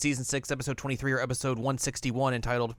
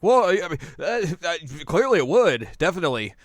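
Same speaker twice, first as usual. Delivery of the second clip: flustered backpedal; 6 semitones higher, 6 dB louder than usual. Retold or imitated. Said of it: "And they're like".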